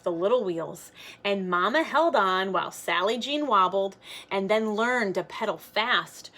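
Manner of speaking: plain reading voice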